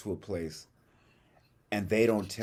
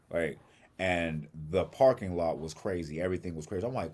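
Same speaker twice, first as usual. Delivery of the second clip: ordinary talk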